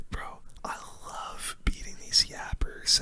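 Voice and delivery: ordinary talk, nothing marked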